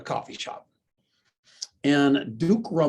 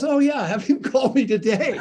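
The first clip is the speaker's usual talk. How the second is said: higher and louder, laughing through it; 9 semitones higher, 6 dB louder than usual. Retold or imitated. Billionaire on the phone agreeing, laughing. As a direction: breaks into a laugh while agreeing. Retold phone call with Brad.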